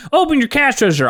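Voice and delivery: high, loud demand; a robber at the counter, high mock demand. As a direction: bark it like a stickup. Acted robber.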